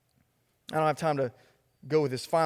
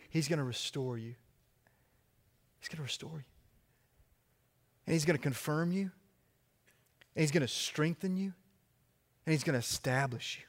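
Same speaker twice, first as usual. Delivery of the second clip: softer, tender promise; soft reassurance; 6 dB quieter than usual. Real, not acted. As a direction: comfort the weary listener quietly.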